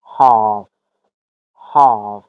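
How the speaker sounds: "هاف" mit langem britischem A, "haaf"; British "هاف", long open A; British accent. Shown as a demonstration. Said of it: "اما بریتیش هاف".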